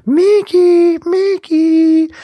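very high, loud, drawn-out falsetto call; Mouth's teasing call. Retold or imitated. Mouth calling to Mikey in the attic, in squealing falsetto. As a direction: stretch each name in a squealing mock voice. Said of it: "you hear his voice go".

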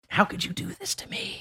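breathy whispered plea to the bag; whispered curse. Retold or imitated. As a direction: hiss it under your breath, betrayed. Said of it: "I'm cursing at this bag".

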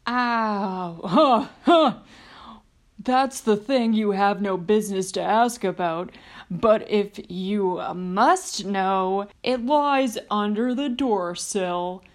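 a big brute let in on a secret; the giant. Acted.

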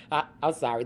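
ordinary delivery

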